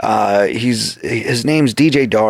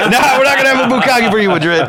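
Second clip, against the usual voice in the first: fast, much higher, laughing through it; mock laughing retort; 13 semitones higher, faster than usual. Teasing Madrid about the party name, fast laughing retort. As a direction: rattle it off while still laughing. Mock reply to his slip.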